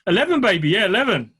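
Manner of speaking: loud, hyped-up celebration; excited. Real, not acted. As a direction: cheer the number like a milestone. Celebrating episode eleven.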